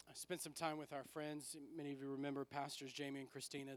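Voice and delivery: usual speaking voice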